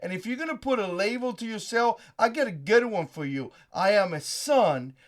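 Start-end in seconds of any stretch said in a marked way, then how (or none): none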